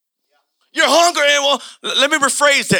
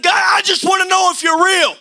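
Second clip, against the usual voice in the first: angry plea to God; 7 semitones higher than usual. Retold or imitated. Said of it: "I'm cursing God out".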